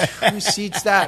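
laughing mock presenter yell; mock presenter shout. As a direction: call out over the whole room, hammed up. Seminar speaker calls out, laughing.

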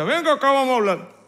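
firm, loud father's summons; stern father calling his son to talk. Retold or imitated. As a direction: call the son in for a serious talk. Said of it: "Papá decía".

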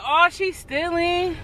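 mock accusation. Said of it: "Then you gonna say".

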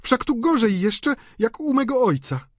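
very high, loud dismay; appalled lady. A narrator's reading. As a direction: exclaim in disgusted astonishment. Delphine appalled by shabby room.

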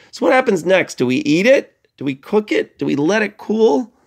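high, bouncy questions; playful quiz voice. Shown as a demonstration. Teacher quizzes students on next step, high and playful. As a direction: tease the kids with the choices.

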